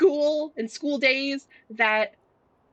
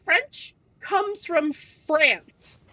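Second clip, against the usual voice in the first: very slow and deliberate, word by word; slow spelled-out explanation; 6 semitones higher, much slower than usual. Retold or imitated. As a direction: spell it out slowly like to a child. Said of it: "I was like".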